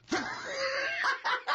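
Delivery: shrieking high laugh. Real, not acted. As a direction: let out a big shouted laugh. Laughing at bow tie memory.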